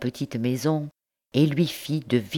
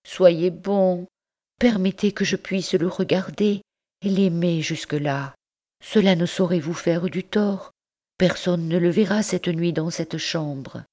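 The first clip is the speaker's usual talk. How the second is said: higher, earnest pleading; supplication; 5 semitones higher than usual. Acted. Mère implore son oncle. (nièce implorant l'oncle de lui laisser l'enfant, voix aiguë)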